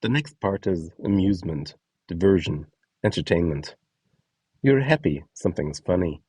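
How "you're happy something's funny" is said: ordinary delivery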